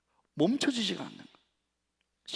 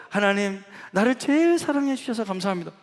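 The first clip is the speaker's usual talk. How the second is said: high, loud imitation of the woman praying; lightly mock her eager prayer; mimicked prayer; 6 semitones higher, 5 dB louder than usual. Quoting the other woman's prayer. (높고 크게 흉내 내는 기도)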